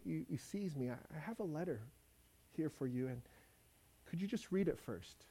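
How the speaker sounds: soft, hesitant, stammering apology; soft stammering plea from a frightened slave; timid pleading. Acted. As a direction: beg quietly, scared of him. Runaway slave begs his master.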